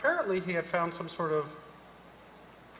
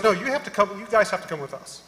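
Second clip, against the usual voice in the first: stern guard order; 5 semitones higher, 5 dB louder than usual. Retold or imitated. Security guard insisting on escort.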